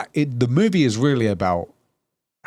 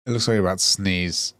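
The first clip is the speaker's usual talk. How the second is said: ordinary talk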